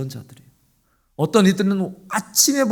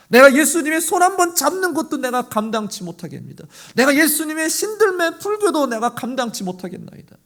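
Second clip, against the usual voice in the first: higher, louder humble protest; humble plea; 7 semitones higher, 3 dB louder than usual. Retold or imitated. Centurion telling Jesus he is unworthy, pleading. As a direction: insist on unworthiness. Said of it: "그 백부장은 뭐라 그래요?".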